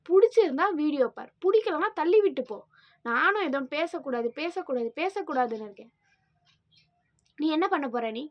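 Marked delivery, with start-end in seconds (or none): 0.1-2.6 loud, sharp and fed up; angry outburst. Real, not acted. Vlogger snapping at trolls, loud and angry. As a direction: throw it back at the trolls, hard. Venting at hateful commenters.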